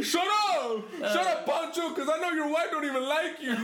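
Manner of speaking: high, laughing retort; mock comeback. Gang member snapping back at his homeboys, high and laughing.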